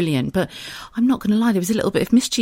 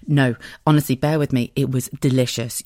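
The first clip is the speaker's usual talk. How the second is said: normal talking voice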